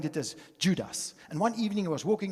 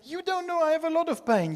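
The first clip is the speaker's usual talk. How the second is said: much higher, louder mock protest; wife retorting to husband, higher and louder; indignant wife; 12 semitones higher, 5 dB louder than usual. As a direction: snap back like the hurt wife. Wife's retort retold.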